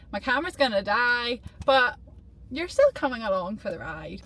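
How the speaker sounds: ordinary delivery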